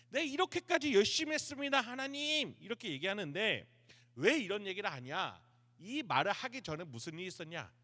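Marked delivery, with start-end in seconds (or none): 0.1-2.6 high, loud aggrieved protest; grumbling complaint. Acted. Elijah grumbles to God that he tried hard, aggrieved protest. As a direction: complain upward in a raised voice. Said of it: "투덜거리면서 얘기하는 거예요".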